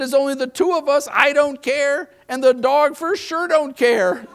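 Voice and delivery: high, loud mock exasperation; husband protests new bedroom, exasperated. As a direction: complain to wife, hammed-up.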